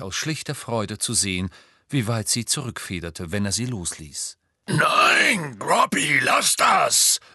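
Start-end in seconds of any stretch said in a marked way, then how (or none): 4.7-7.2 high, loud warning shout; shouted scolding. A narrator's reading. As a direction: order the giant to stop at once. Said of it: "rief Hagrid". (lautes, warnendes Rufen)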